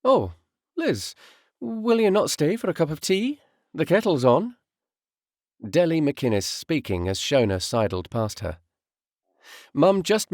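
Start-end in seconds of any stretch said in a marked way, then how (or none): 0.0-4.6 high, bright-pitched neighbour voice; a kindly Scottish neighbour pressing her to stay; neighbour's offer. A narrator's reading. Neighbour urging the widow to stay for tea, high and coaxing.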